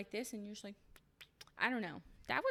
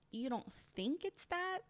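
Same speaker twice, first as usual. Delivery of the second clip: incredulous echo; 6 semitones higher than usual. Real, not acted. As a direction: pitch jumps higher on "that", disbelieving. Incredulous echo of his words.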